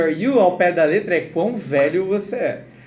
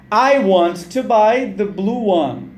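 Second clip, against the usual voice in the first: "I want to buy the blue one" at half speed; word by word for repeating; slow model reading; 4 dB louder, much slower than usual. Shown as a demonstration. Teacher to students repeating the answer, slow model.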